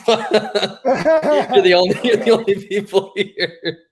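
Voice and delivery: high, slow laughing words; high laughing disbelief. Real, not acted. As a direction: laugh out the punchline. Laughing at the absurd retort.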